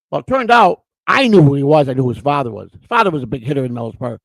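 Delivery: ordinary talk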